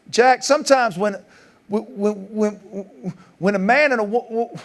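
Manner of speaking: high, halting, repeating himself with nerves; a dad floundering with no words; panicked stammer. Retold or imitated. Retelling his panic while starting the sex talk with his stepson. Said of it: "I panicked".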